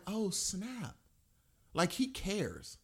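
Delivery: hushed retold amazement. Podcaster recalling the moment Rey gets taken, hushed amazement. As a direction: drop the volume as if stunned. Retold reaction to the scream scene.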